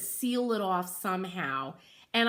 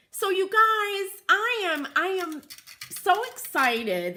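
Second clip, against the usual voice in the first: excited wrap-up; 9 semitones higher, 5 dB louder than usual. Real, not acted. Wrapping up the live class.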